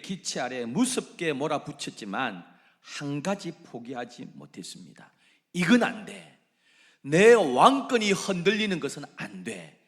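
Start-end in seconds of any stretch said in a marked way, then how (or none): 5.5-9.8 loud, high insistence; adamant refusal. Acted. Jehu refusing to let go of power, loud. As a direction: refuse to give up the throne.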